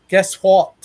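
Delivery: put-on Scottish accent, ghost voice on tape. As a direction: mimic a Scottish woman on a recording. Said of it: "with this Scottish accent".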